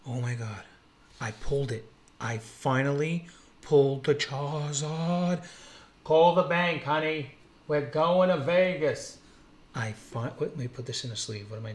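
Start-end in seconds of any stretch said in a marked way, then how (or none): 2.2-9.2 loud, high burst of joy at the big pull; ecstatic shout. Real, not acted. Card collector yelling to viewers after pulling the Charizard, ecstatic shout. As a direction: yell it like you just hit the jackpot.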